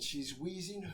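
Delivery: usual speaking voice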